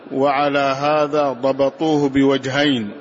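ordinary talk

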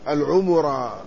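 العمرة with a u on the m, long ā ending; double damma. Shown as a demonstration. Lecturer showing a reported variant, العمرة as al-ʿumurā. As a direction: say العمرة as al-ʿumurā, u after ʿayn and mim. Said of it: "مع ضم أوله".

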